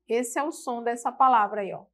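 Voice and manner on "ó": ordinary delivery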